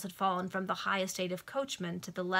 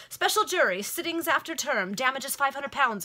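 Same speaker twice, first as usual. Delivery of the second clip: high, raised-voice legal outburst; exclaiming; 6 semitones higher, 6 dB louder than usual. A narrator's reading. Lawyer claiming damages for plaintiff, exclaiming. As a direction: rattle off the claim as a loud exclamation. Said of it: "exclaimed Mac Chisel".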